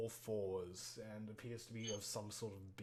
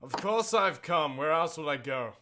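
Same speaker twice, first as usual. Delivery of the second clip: voice in the darkness; 4 semitones higher, 10 dB louder than usual. Acted. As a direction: project it like a figure calling from the dark. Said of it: "you hear a voice in the darkness".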